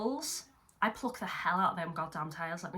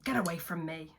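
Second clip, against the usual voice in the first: usual speaking voice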